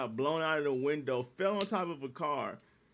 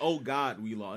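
defeated; 7 semitones lower than usual. Retold or imitated. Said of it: "he said".

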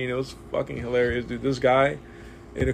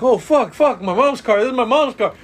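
high, loud panicked wail about mom's car; hammed-up high panicked cry; mock panic; 9 semitones higher, 6 dB louder than usual. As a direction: freak out over wrecking mom's car. Guy panics after crashing car.

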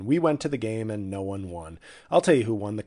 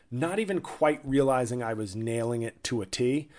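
usual speaking voice

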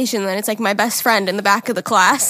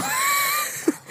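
high laugh burst; 7 dB quieter than usual. Real, not acted. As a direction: shriek with laughter.

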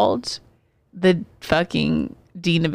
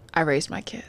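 whispered, half-voiced grandmother line; hushed nana; 4 dB quieter than usual. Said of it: "my nana to her was like".

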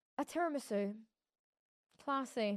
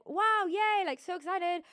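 much higher and louder, gushing; mock excited squeal; 9 semitones higher, 6 dB louder, slower than usual. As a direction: burst out like a thrilled fan. Imagined delight at the menu.

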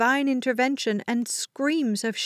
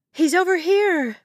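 high, loud call; yell; 8 semitones higher, 5 dB louder than usual. A narrator's reading. Woman telling mother the boy is found, yelling. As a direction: yell to someone across the crowd. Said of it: "Meredith yelled".

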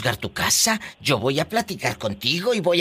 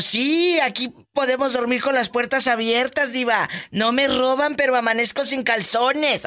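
higher voice for the lady from Tehuacán; quoting a caller; 8 semitones higher than usual. Retold or imitated. Host imitates a listener's cheeky joke, lightly higher voice. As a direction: lightly play the cheeky señora. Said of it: "me dijo".

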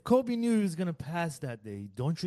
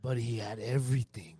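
evil villain voice; 6 semitones lower than usual. Acted. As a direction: say it like a sinister villain. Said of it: "Why did you sound like an evil villain".

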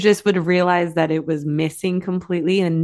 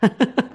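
short rising laugh; a quick real laugh; 6 semitones higher than usual. Real, not acted. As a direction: laugh warmly at the wrap-up. Host laughs at story ending.

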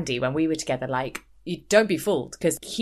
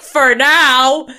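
loud, higher, cutting; snide retort; 6 semitones higher, 14 dB louder than usual. Retold or imitated. Mimicking Kim heckling the photo, loud and snide. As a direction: throw it back with menace. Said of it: "And Kim's like".

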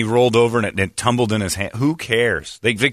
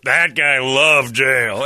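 higher, drawn-out retold exclamation; out-loud retort; 5 semitones higher, 4 dB louder, much slower than usual. Viewer heckling the man on the news, retold out loud. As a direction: blurt it out slowly at the TV. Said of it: "I out loud said".

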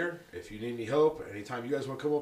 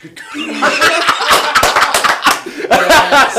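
shouted while laughing; 22 dB louder, much slower than usual. Pretend. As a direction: yell it through a laugh.

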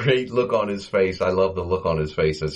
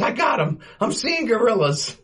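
high squeaky panicked Curly voice, much higher; imitating scared Curly; 13 semitones higher than usual. Podcaster retelling Curly spotting the monkey, high panicked squeak. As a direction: jump way up in pitch like a frightened Stooge.

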